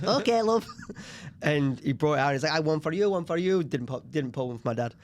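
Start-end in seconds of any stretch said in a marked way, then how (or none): 0.0-0.7 high, loud Yorkshire mum voice; thick Yorkshire accent. Retold or imitated. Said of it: "Thick Yorkshire accent". 2.5-3.6 put-on Italian accent. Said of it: "very Italian man".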